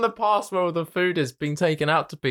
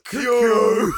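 louder, sudden Kyo; loud call; 2 semitones higher, 4 dB louder than usual. Retold or imitated. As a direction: call it out at someone. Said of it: "I didn't just wanna go".

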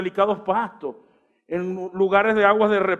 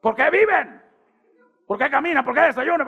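high, loud shrieking questions; mock wife yelling; 7 semitones higher, 3 dB louder than usual. Pastor imitating his wife yelling at the family. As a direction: yell every question up high. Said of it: "nos gritaba por todo".

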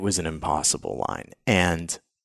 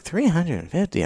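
high, stunned disbelief; incredulous surprise; 10 semitones higher than usual. Retold or imitated. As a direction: gasp out the number in disbelief. Incredulous reaction to notifications.

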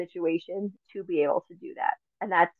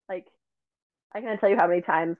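ordinary delivery

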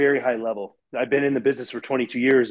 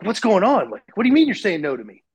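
higher-pitched retold disbelief; baffled protest; 6 semitones higher than usual. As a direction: act out bewildered protest at being refused. Baffled at being turned down.

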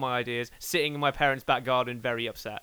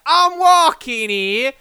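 loud, high, very slow put-on New York accent; a Brit's overblown New Yorker; bad New York accent; 10 semitones higher, 11 dB louder, much slower than usual. Shown as a demonstration. British guest demoing his bad American accent, New York accent. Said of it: "any American accent I do eventually just fucking devolves".

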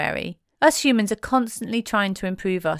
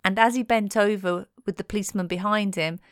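ordinary talk, nothing marked

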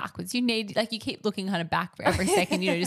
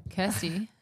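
usual speaking voice

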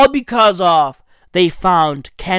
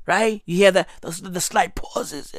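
soft, low, fading voice; losing voice; 3 semitones lower, 7 dB quieter than usual. Real, not acted. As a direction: let the voice fade as it gives out. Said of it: "I'm losing my voice".

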